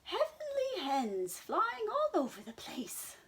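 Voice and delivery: high loud hen voice; clucking hens. Acted. Chickens pointing at the sky, high loud clucking. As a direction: cluck it out excitedly.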